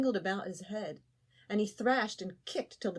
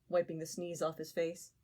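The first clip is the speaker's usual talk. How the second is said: ordinary delivery, nothing marked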